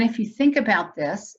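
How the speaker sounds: ordinary talk, nothing marked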